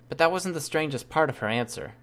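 usual speaking voice

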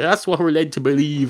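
ordinary talk, nothing marked

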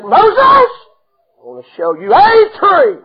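high, slow, loud proclaiming; voice of God. Acted. God answering Moses, slow ringing proclamation. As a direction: call it out like a revelation.

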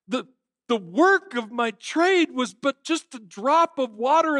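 high, slow anguished cry of a remorseful ghost; keening, slow ghostly lament, pitched high; shrieking ghost. Acted. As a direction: wail it out like Marley in despair. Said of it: "Marley shrieks in response".

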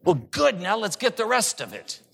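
high, punchy mock-cheerful comeback; gleeful reply. Acts the brisk reply to low self-esteem.

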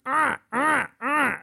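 high imitated raven squawks; raven cawing. Mocking the actor's research clip, high raven caws. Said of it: "a raven going".

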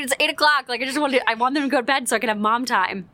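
normal talking voice

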